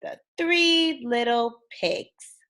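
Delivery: put-on lively read-aloud for kids, bouncy tune; storybook reading. A narrator's reading. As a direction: read it like bedtime story time.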